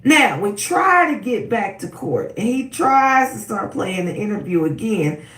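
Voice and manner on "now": ordinary talk, nothing marked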